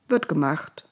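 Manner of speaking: usual speaking voice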